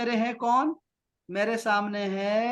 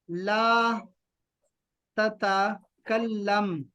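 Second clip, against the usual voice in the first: "ला ... ततकल्लम" very slow, pause after ला; broken-up word; much slower than usual. Shown as a demonstration. Teacher breaks new word into parts.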